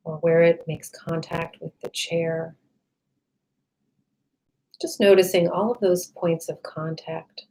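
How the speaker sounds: calm meditation voice with long, restful pauses; guided meditation. Real, not acted. Meditation guide leading listeners to relax the body, calm and unhurried.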